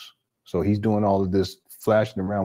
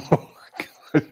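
helpless, high laughing exclamation; cracking up; 5 semitones higher than usual. Real, not acted. Host reacting to the crude clip, cracking up. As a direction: barely get it out for laughing.

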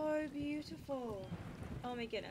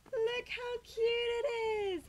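squealing with delight; gushing; 11 semitones higher, 10 dB louder than usual. Real, not acted. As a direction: gush over the cute kitten. Cooing over kitten.

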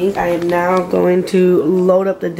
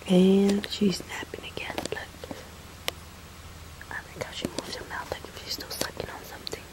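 whisper; 13 dB quieter than usual. Real, not acted. Mom whispering over her napping baby.

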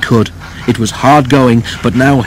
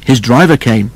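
ordinary delivery

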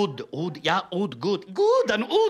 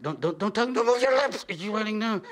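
ordinary delivery

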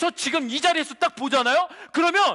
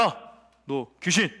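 exorcist's command; 13 semitones lower than usual. Acted. Priest's sons ordering out an evil spirit, low command into shout. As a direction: draw it out deep and hushed, then burst out. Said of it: "딱 이렇게 얘기한 거야".

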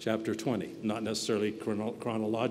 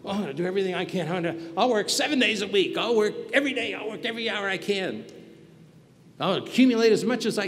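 higher and fast, a mock eager workaholic; preacher mocking a greedy overworker, higher and fast; 8 semitones higher, faster than usual. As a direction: play the go-getter who never rests, pitched up and rushed. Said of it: "What would a lot of you be doing?".

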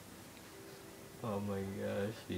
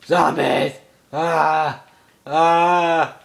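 loud, higher frightened yelps; startled screams; 5 semitones higher, 10 dB louder, much slower than usual. Real, not acted. Gamer jumping at zombies, startled screaming. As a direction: scream at the sudden scare.

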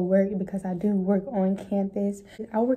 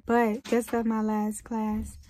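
normal talking voice

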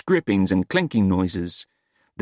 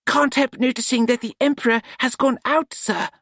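put-on higher, cheerful young voice; cheerful apprentice; 12 semitones higher than usual. A narrator's reading. Nut telling Smeems the candle is out, cheerful higher voice. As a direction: point it out brightly and innocently. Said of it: "said the voice from below cheerfully".

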